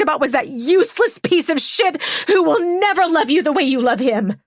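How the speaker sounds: loud furious shout. Acted. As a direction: let the grief turn to a loud shout. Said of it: "Hannah's voice was so loud".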